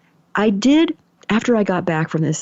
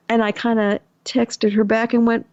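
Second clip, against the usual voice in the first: ordinary talk, nothing marked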